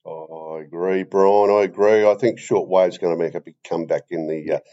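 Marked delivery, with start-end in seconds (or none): none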